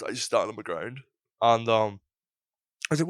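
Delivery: ordinary talk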